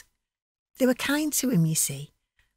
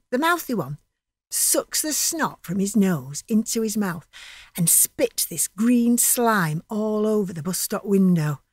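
ordinary delivery, nothing marked

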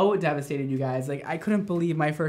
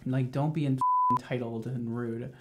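ordinary delivery

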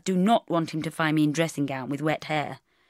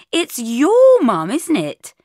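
higher, louder, slow and accusing; triumphant realisation; 10 semitones higher, 11 dB louder, slower than usual. Acted. Bridget catching Mark out about his mother, slow triumphant accusation. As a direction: pounce on the realisation.

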